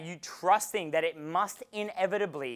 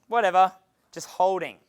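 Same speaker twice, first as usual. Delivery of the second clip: ordinary talk, nothing marked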